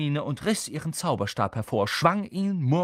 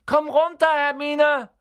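calling out; 7 dB louder than usual. Acted. Calling up to Hermine.

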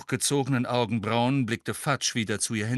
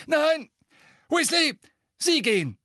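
much higher, louder barked order; barking; 6 dB louder than usual. A narrator's reading. Fudge ordering Percy out, barking. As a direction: bark it at the assistant. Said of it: "bellte Fudge Percy an".

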